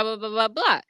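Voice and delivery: ordinary delivery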